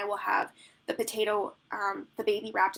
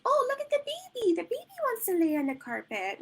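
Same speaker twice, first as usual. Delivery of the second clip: very high, bright oh sliding down; sing-song baby talk; 14 semitones higher than usual. Retold or imitated. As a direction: gush at the baby to draw the toddler in. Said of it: "I sound ridiculous when I'm doing sessions".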